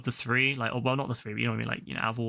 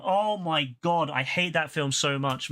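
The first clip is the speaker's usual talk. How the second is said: usual speaking voice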